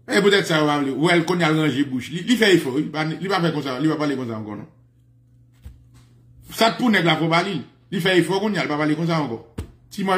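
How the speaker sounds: ordinary talk, nothing marked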